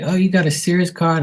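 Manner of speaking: high-pitched eager question; imitated saleslady. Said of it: "And she'd be like".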